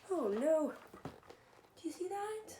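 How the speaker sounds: ordinary delivery, nothing marked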